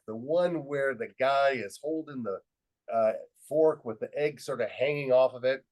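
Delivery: normal talking voice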